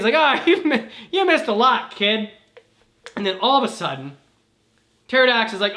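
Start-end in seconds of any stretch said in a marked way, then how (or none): 0.2-2.3 higher, louder, breaking into laughs; laughing reply. Acted. Older hero telling the dazed kid, through laughter. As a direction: chuckle through the line.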